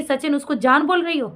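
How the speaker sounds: usual speaking voice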